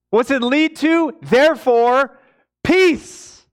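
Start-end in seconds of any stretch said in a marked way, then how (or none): 1.2-3.4 Therefore rising, then peace much higher and louder; emphasis on peace. Shown as a demonstration. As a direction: build through Therefore and ring out on peace. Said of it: "What's the emphasis Paul's talking about?".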